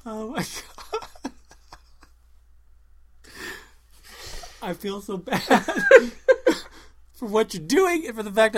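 high-pitched, breathless fits of laughing; helpless laughter. Real, not acted. Co-host guilty about laughing at the accent, helpless laughter. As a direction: gasp the words between real laughs. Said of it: "I'm laughing at it".

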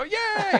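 high, loud mock cheer; crowd cheer. Crowd delighted by the candidate, high mock cheer. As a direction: let out a high-pitched yay. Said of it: "And they go".

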